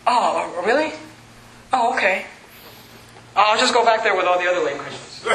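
mock-deflated acceptance; meekly giving up. Preacher imitating discouraged Christians, meek and deflated. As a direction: imitate someone backing down, a little subdued.

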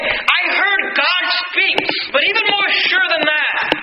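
very slow, high shout of witness; Peter shouting. Acted. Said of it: "And Peter's saying".

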